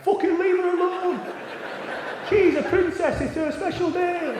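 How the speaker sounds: high, loud mock outrage, words drawn out; protective outrage. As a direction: imitate hens defending their friend, shouting high. Hens defending bride, acted out.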